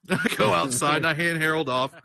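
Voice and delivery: ordinary talk, nothing marked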